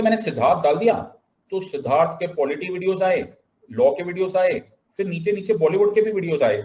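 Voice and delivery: high, rising list of discoveries; acted-out excited report; excited friend. Friend reporting his search results excitedly.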